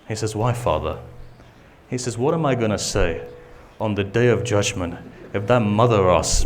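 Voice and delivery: very low, soft storytelling with long pauses; hushed sermon lament. Acted. Preacher retelling Hur's confession to his son, hushed and sorrowful. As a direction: let the father and son's exchange sink almost to nothing.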